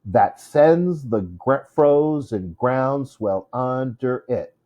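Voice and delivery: slow, heavy alternate beats on "That sends the frozen ground swell under it"; iambic scansion. Shown as a demonstration. Teacher reading the second line, slow exaggerated meter. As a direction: hit every second syllable, slowly. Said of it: "iambic pentameter".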